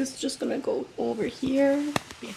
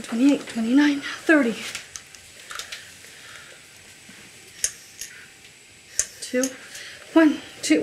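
strained counting while pushing; loud effortful counting; 5 dB louder than usual. Acted. Counting loudly during compressions.

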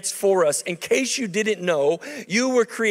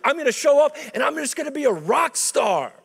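dreamy rock-star bravado; 7 semitones higher than usual. Retold or imitated. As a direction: puff up like a kid who's sure he'll be famous. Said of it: "And I thought".

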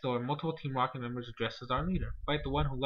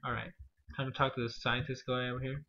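ordinary delivery

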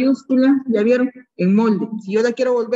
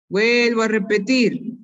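ordinary talk

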